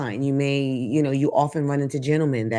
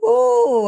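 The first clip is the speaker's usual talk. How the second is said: delighted squeal; 6 dB louder than usual. Real, not acted. Laughing at own joke.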